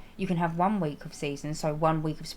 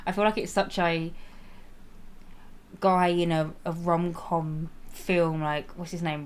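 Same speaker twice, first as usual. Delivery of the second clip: usual speaking voice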